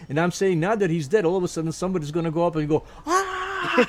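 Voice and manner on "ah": mock startled shriek. Startled at seeing dead man's lookalike.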